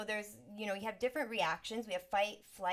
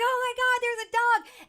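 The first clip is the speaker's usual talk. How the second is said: much higher and louder squeal of delight; thrilled child; 14 dB louder than usual. Retold or imitated. Little girl spots a dog, squealing with delight. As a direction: shriek with joy at seeing a dog. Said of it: "I was like".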